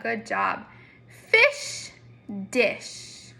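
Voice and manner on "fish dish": playful teacher rhyme pair; rhyme demo. Shown as a demonstration. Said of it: "Thumbs up or thumbs down?".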